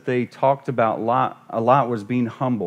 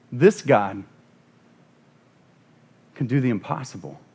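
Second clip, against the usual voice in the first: normal talking voice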